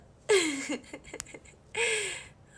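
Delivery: high loud burst of laughter; real hearty laugh. Real, not acted. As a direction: crack up out loud. Laughing at how it turned out.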